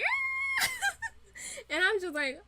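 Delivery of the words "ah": a shrill high charging scream, hammed up; mock battle scream. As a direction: scream it like a man running downstairs.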